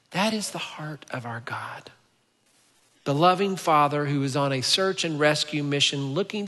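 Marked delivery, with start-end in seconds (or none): 0.1-1.9 breathy hushed whisper. Real, not acted. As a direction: drop to an intimate whisper. Preacher's hushed key point.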